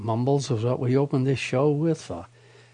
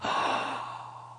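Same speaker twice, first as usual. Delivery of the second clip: soft mock gasp of amazement; quiet gasp at the absurd finding; 10 dB quieter than usual. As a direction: draw in a soft, astonished breath. Reacting to article's punchline.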